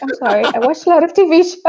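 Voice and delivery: fast, high, laughing apology; sheepish giggling excuse. Retold or imitated. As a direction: laugh it off, embarrassed. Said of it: "Who are you talking to".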